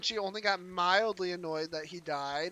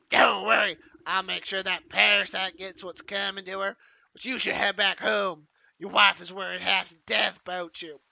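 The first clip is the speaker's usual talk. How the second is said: granny voice; 7 dB louder than usual. Acted.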